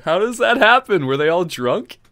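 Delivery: high, loud disbelief; can't believe what you just heard; incredulous. Real, not acted. Reacting to absurd fun fact.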